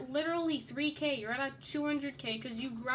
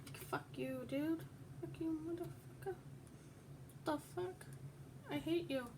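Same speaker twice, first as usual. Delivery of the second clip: ordinary delivery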